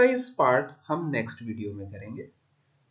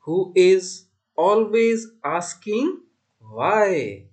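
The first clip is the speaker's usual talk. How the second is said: slow, deliberate verse with stretched words; poem recitation; 4 semitones higher, 7 dB louder, much slower than usual. Shown as a demonstration. Teacher reciting a poem for class four, slow deliberate recitation. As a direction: recite it line by line, loud and measured.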